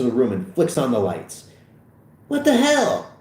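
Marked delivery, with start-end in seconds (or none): 2.3-3.1 high-pitched alarmed yelp; startled high cry; startled outrage. Acted. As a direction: react in shock, voice shooting up. Fogel startled by officer.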